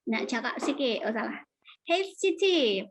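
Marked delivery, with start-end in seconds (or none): 1.8-2.8 high, drawn-out call. Shown as a demonstration. Teacher reads the example as a call to Siti, calling out. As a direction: call out to get Siti's attention.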